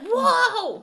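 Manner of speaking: put-on high, awed little-kid gasp; wholesome 1950s kid. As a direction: gasp like an impressed boy. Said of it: "And he's like".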